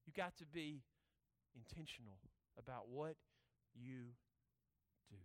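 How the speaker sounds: low, soft, broken by long pauses; quiet emphasis. Real, not acted. Preacher charging listeners to be intentional, quiet emphasis. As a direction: lay it out softly, pausing between phrases. Slow hushed charge after the verdict.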